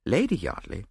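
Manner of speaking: plain reading voice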